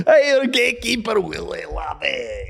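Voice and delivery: high, hammed-up Scottish cartoon voice, falling away; Gamekeeper Willie. Co-host playing along with the tease, Gamekeeper Willie voice. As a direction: wail it high in a broad Scottish accent. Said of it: "Gamekeeper Willie from The Simpsons".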